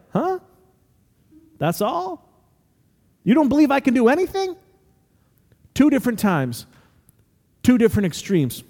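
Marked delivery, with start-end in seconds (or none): 0.1-4.6 higher-pitched, let-down disbelief; incredulous amazement. Acted. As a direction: question it as if you expected far more. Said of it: "Other times he's amazed, like".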